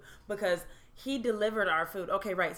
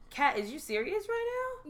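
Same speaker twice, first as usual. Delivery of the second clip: incredulous; 9 semitones higher than usual. Retold or imitated. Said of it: "I was like".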